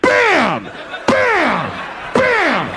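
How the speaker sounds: high, loud hammed-up bams; mock bam. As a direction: punch each bam out loud. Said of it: "She's going like".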